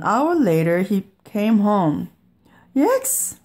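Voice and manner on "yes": very high yelp; sudden high exclamation; crying out. A narrator's reading. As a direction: cry out, pitched way up. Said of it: "he cried".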